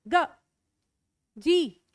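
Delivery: ordinary talk, nothing marked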